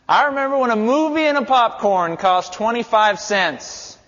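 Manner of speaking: mock grumbling old-timer. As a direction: ham up a griping elder pining for the past. Mocking the complaining elders.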